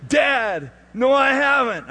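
high, loud wail of objection; retold loud protest. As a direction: imitate a son crying out in protest. Son protests being cut off.

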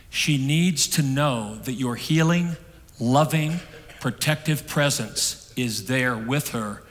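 slow, each word weighed: healing, loving, protective; slow and deliberate. Retold or imitated. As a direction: lay out "healing, loving, protective" slowly and evenly. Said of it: "The counselor said".

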